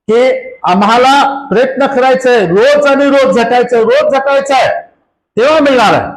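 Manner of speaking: high-pitched, driving sermon delivery; impassioned preaching. Real, not acted. (उंच स्वरात जोशपूर्ण प्रवचन)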